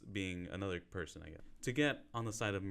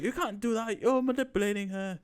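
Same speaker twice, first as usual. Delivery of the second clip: high, loud imitation of an objecting man; much higher mock outrage; mock protest; 13 semitones higher, 6 dB louder than usual. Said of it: "And he's like".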